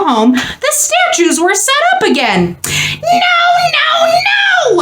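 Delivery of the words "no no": squeaky wail. A narrator's reading. Mouse finding the statues back, wailing squeak. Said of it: "squeaked Mortimer".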